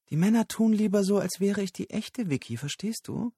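put-on quick, very high girlish lilt; high streetwalker voice. A narrator's reading. Street girl explaining to Lyle, quick and high. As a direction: rattle it off high and coy.

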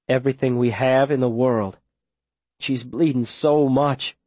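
ordinary delivery

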